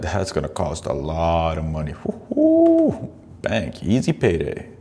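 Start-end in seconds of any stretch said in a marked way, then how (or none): 2.0-3.1 high, far-off woo-hoo; hammed-up celebration; mock gold-digger cheer. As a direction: squeal it like a cashed-in payday. Imagining the divorce payday.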